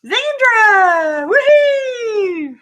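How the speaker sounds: high, loud cheer to a viewer; excited greeting. Real, not acted. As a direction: whoop a welcome to Zandra. Greeting viewer on live stream.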